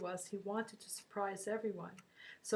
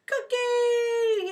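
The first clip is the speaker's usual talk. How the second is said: high, loud happy yell; joyful yell; 12 dB louder than usual. Retold or imitated. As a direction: shout her name with joy. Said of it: "he yelled out".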